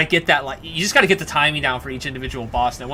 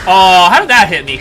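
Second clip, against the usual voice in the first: loud outraged cry; raging gamer; 5 semitones higher, 11 dB louder than usual. Real, not acted. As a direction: yell in disbelief. Hit by boss, outraged.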